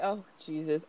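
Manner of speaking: a light, soft imitation of Jeremy's "Oh, Jesus"; imitating Jeremy's dry line. Shown as a demonstration. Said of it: "I say it just like that".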